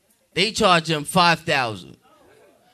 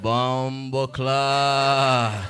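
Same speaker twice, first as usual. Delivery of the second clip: very slow, low Jamaican curse; Jamaican cursing; 7 semitones lower, much slower than usual. Retold or imitated. Jamaican man fined for cursing in court, Jamaican cursing. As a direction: draw the curse right out in Jamaican accent. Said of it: "Jamaican men".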